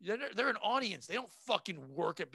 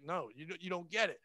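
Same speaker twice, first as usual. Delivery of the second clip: usual speaking voice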